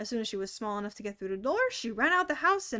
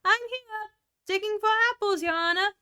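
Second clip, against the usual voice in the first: much higher, louder servant voice; new character voice; 10 semitones higher, 9 dB louder than usual. Acted. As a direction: pipe up like an eager little servant. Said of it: "a voice that she had never heard before".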